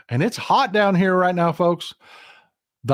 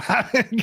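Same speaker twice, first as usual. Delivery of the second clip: brief incredulous chuckle; short laugh; 7 semitones higher than usual. Real, not acted. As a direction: let a laugh slip out. Laughs at the bigots' words.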